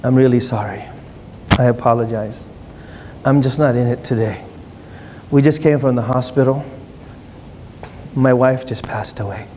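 a widower quietly apologising, worn out; grieving apology. Retold or imitated. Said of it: "he looks at you and he says".

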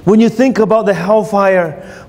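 ordinary delivery

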